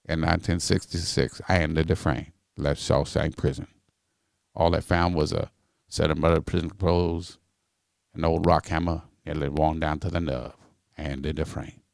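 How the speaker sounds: very low, measured movie-narrator voice; Morgan Freeman. Acted. Comedian showing the host a film-narration impression, deep and measured. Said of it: "This is Morgan Freeman".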